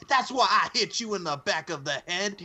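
high-pitched hammed-up promo line; put-on high aggrieved voice; mock wrestling promo. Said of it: "That's what he said".

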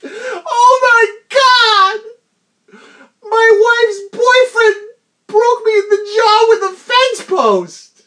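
high, loud, howling with laughter; laughing shout. Real, not acted. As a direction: cry it out in laughing disbelief.

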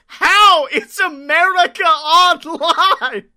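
Breeze's shocked outburst, cracking up; incredulous laughing shout. Retold or imitated. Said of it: "Breeze goes".

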